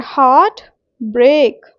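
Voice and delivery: Heart and break slow, each equally stressed. Shown as a demonstration. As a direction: give heart and break separate, equal beats. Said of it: "दोनों में ही equal stress जा रहा है". (Heart और break, दोनों पर बराबर ज़ोर)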